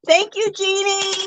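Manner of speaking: high, drawn-out excited thank-you; celebratory cheer. Real, not acted. Streamer thanking a donor with bubbles, celebratory cheer.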